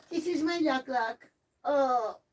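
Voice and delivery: high doting pet voice; puppy talk. Real, not acted. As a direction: coo over the little dog. Showing the puppy on camera.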